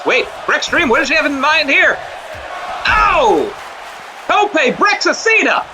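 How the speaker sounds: yelling the big dive; erupting at a high-flying move; excited shout. Acted.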